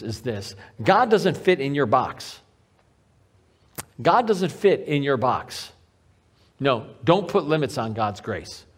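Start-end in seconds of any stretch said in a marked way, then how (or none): none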